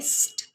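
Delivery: स्ट as a held "sss" running into "t"; blended sound. Shown as a demonstration. Teacher joining S and T sounds, blended phonics स्ट. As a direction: blend स्ट in one go, hiss then tap. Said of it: "एक साथ बुलवाएंगे".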